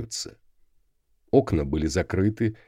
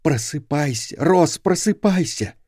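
urgent whisper; 6 semitones higher than usual. A narrator's reading. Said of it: "отчаянно шептала ему в ухо".

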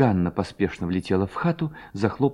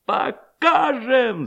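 very high, long-drawn cry; crowd shouting assent; 14 semitones higher, much slower than usual. A narrator's reading. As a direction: stretch the word into a rallying yell. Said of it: "закричали парубки". (протяжный высокий крик)

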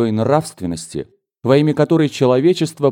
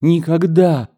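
high, raised cry of refusal; emphatic exclamation; 6 semitones higher, 3 dB louder, slower than usual. A narrator's reading. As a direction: cry it out higher, with full insistence. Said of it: "снова воскликнула она".

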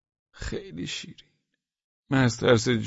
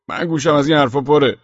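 gruff dismissal; 3 semitones higher, 8 dB louder than usual. Acted. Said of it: "پلیس گفت".

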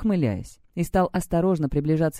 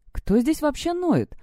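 much higher, slow indignant question; flaring indignation; 9 semitones higher, slower than usual. A narrator's reading. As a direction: boil over at the accusation. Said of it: "тут же вскипела Тандер". (возмущённо вскипая)

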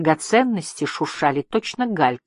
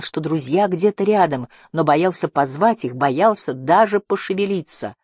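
plain reading voice

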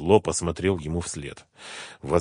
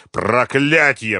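slow, loud, drawn-out curse; angry roar; 6 semitones higher, 6 dB louder, much slower than usual. A narrator's reading. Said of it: "взревел Мурат". (яростный рёв, протяжно)

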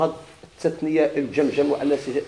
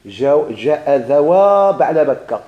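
slow, stretched vowels; drawn-out model form; 4 dB louder, much slower than usual. Shown as a demonstration. Teacher showing the dual of a compound name, drawn out. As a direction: dwell on the long ending of ذوا.

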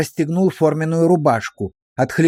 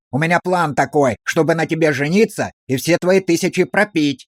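ordinary delivery, nothing marked

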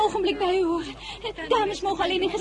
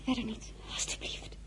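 whispered, hushed plea; 9 semitones lower, 13 dB quieter, faster than usual. Acted. Girl hiding her surname from the general, whispered. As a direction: breathe it so nobody hears.

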